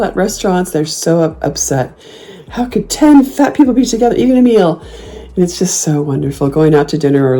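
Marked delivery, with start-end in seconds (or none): 2.5-4.8 high, outraged onlooker; mock outrage. Imitating shocked diners, high and outraged. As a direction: gasp at it like a scandalized diner.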